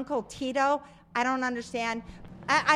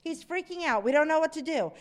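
ordinary talk

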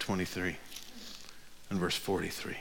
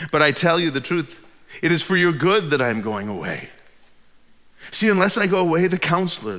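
louder and higher, proclaiming the verses; raised preaching read; preaching scripture; 6 semitones higher, 7 dB louder than usual. Acted. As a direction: declare each promise to the room. Reading Jesus' words with rising force.

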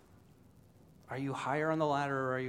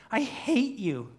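high, slow, louder wail; anguished cry; 7 semitones higher, 4 dB louder, slower than usual. Acted. Preacher as crying Smeagol facing Gollum, wailing. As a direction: wail it out at your tormentor. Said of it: "And as Smeagol cries".

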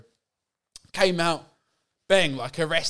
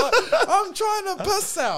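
much higher, slow laughing whine; exasperated whine; 14 semitones higher, 3 dB louder, slower than usual. Retold or imitated. Complaining about fans bugging him mid-piss, high slow whine. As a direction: stretch it out high and fed-up.

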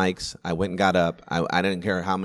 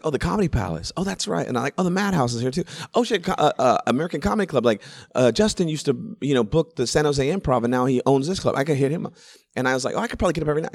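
high, eager rush of ideas; growing excitement; 7 semitones higher than usual. Retold or imitated. On vacation, realizing the comedy clubs nearby, mounting excitement. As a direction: get more thrilled with each club.

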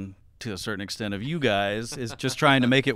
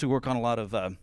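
normal talking voice

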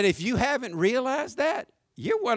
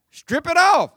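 louder, higher mocking echo; mocking strip it off; mock congregant; 8 semitones higher, 7 dB louder than usual. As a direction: throw his own words back at him. Congregant voiced mocking the pastor.

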